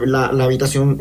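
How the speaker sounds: usual speaking voice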